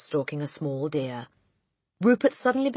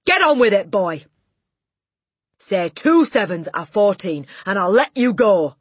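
loud, high, threatening tirade; bully with full-volume shouted orders; bellowing Trunchbull; 6 semitones higher, 14 dB louder than usual. A narrator's reading. Headmistress threatening the dangling boy, furious bellowing. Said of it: "bellowed the Trunchbull".

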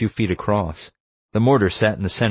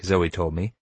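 plain reading voice

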